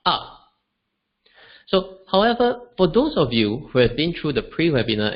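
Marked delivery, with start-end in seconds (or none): 0.1-0.5 "ah" falling sharply; "ah" as the falling fourth tone. Shown as a demonstration. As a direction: drop "ah" down hard. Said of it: "the four tones of Mandarin Chinese".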